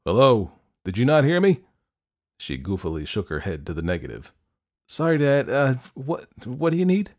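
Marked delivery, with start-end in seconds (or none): 0.1-1.6 louder, impatient fatherly call; exasperated dad calling out; dad yelling. A narrator's reading. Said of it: "her dad yelled". 4.9-7.1 high-pitched, stumbling apology; startled, halting teenage girl; flustered reply. A narrator's reading. Flustered girl answering her dad.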